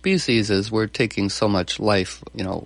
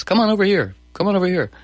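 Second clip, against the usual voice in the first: inviting call; 8 semitones higher, faster than usual. Retold or imitated. Voicing the irresistible invitation.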